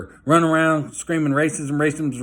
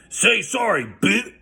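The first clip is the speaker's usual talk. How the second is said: imitating a menacing stranger, pitched up; mock tough guy; 7 semitones higher, slower than usual. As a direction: put on the bully's sneer. Retold threat from a stranger.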